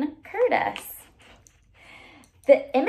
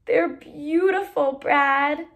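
husky voice; 5 semitones higher, slower than usual. A narrator's reading. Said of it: "said Katie in a husky voice".

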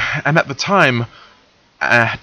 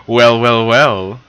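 sly; 5 semitones higher than usual. Acted. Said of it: "he replied in a sly tone".

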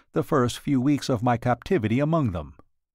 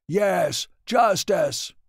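higher, rousing cry of agreement; eager echo; 5 semitones higher, much slower than usual. Acted. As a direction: call it out like a crowd joining in. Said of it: "echoed a dozen voices".

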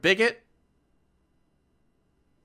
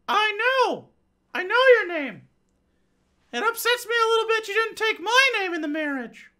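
much higher, squeaky, whiny man-child with a fake cry; high comic falsetto husband, slowed and sobbing; 14 semitones higher than usual. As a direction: play the husband as a squealing big baby. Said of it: "her conservative husband".

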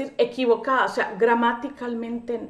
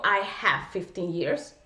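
"I have fifteen years" said slowly and lower as the mistake; slow wrong version; 6 semitones lower, 5 dB quieter, slower than usual. Shown as a demonstration. Teacher showing students the incorrect phrase slowly. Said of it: "Yo no digo".

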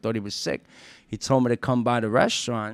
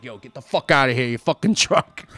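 put-on firing the drunk; 2 semitones higher, 9 dB louder than usual. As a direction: bark him out of the kitchen, hammed up.

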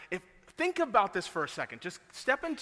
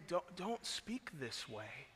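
tender plea; 9 dB quieter, much slower than usual. Retold or imitated. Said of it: "I believe he said it in tenderness".

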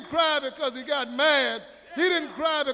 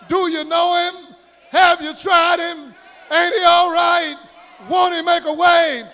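climactic sermon shout, building louder in short rhythmic bursts; full-force preaching; 2 semitones higher, 7 dB louder than usual. Real, not acted. Preacher closing with praise of Jesus, asking do you know him, full-force preaching. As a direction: cry out each line of praise to rouse the room.

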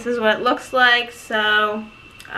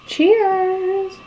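bright, lifted cheers; cheerful toast; 9 semitones higher than usual. Real, not acted. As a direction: toast it happily. Raising a glass of wine.

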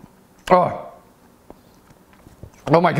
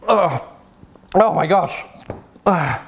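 hiccuping between words; talking through hiccups; 4 semitones higher than usual. Real, not acted. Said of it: "I got the hiccups".

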